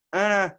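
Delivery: high, loud nonsense tone; just a pitched sound, no word; calling Rio with a noise instead of her name; wordless dog-calling noise. Shown as a demonstration. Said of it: "make the noise with my mouth".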